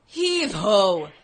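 lightly gruff hollered heave ho; gruff dock worker hollering. Pretend. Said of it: "Can you do an impression of me?".

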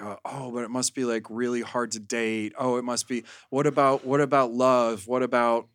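ordinary talk

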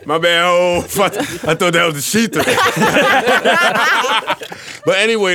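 loud laughing through words; laughing loud, high and giddy; rowdy party animal. Real, not acted. Laughing after mistaken [name] comment.

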